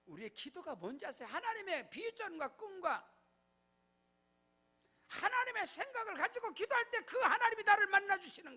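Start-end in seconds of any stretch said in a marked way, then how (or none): 1.3-8.6 loud, high pulpit proclamation; full-force preaching. Acted. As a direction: decree it over the congregation. Preaching climax. (강단에서 외치는 설교)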